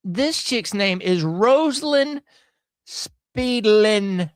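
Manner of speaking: slow, deliberate, each word of the name drawn out; slow, savoring. Real, not acted. Host reading the judge's name aloud to viewers, slow and savoring. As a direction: savor every syllable of the name. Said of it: "This is hilarious".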